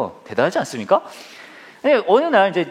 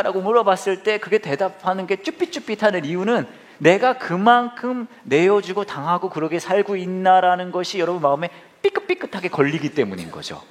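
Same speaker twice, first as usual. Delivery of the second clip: usual speaking voice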